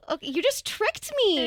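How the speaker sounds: high amused realization; mock surprise. Mom realizing her daughter tricked her, amused mock surprise. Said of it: "And I'm like".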